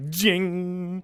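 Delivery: high, ringing "jing" sound effect; vocal metallic ring; imitated arrow strike.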